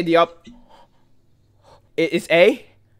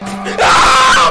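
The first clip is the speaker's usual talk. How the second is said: long scream of fright; frightened scream. Real, not acted. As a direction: scream at a sudden scare. Final jump scare.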